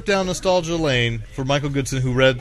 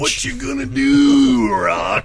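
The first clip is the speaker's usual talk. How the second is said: high, slow shouted taunt; wrestling taunt; 11 semitones higher, much slower than usual. Acted. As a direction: bellow it like a pro wrestler. Wrestler taunt joins promo.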